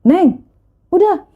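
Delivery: high-pitched voiced bystander stepping in; lightly imitated consoling stranger; imitated older man. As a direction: act out a man hushing someone crying. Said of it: "ada bapak-bapak yang bilang".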